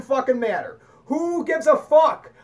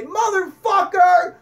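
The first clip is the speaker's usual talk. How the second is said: slow, loud, high shouted curse; drawn-out furious curse shout; worked-up curse; 8 semitones higher, 6 dB louder, slower than usual. Pretend. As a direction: bellow it out like losing your temper. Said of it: "get all worked up and say".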